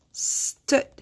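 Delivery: "stood" as a long "sss", then "tood"; sounded out. Shown as a demonstration. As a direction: draw out the S of stood, then pause. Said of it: "How many vowel sounds".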